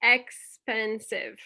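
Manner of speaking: "Elastic" dragged out very slowly; reading a student's word aloud; slow syllables. Shown as a demonstration. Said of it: "And Nicole".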